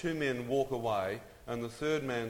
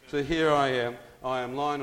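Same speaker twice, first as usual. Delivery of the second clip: loud emphatic; 3 semitones higher, 8 dB louder than usual. Real, not acted. Storyteller's emphatic return.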